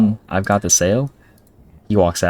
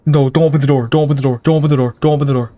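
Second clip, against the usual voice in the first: scared pleading; 6 semitones higher, faster than usual. Real, not acted. Gamer begging the character not to open the door, high and scared. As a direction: plead over and over in a fright.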